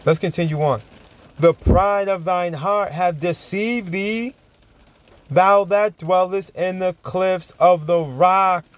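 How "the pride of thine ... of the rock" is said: slow, raised preaching read of scripture. Acted. Preacher reading a Bible verse to his audience, slow, raised intonation. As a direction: intone the verse slowly to the congregation.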